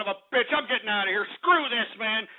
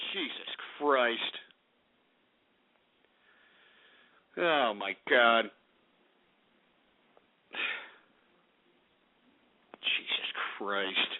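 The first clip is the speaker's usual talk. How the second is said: low, slow, soft sighing exclamations; depressed; 8 dB quieter, slower than usual. Real, not acted. Host alone, crushed by the prank, depressed muttering. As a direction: sink down slowly, utterly drained. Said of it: "I mean, I'm depressed".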